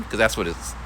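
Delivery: ordinary delivery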